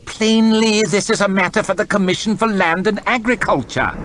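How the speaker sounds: high, disdainful lordly voice; a bored, arrogant official; haughty lord. Acted. Lord chamberlain announcing to his court, disdainful. Hoff dismisses the peasant.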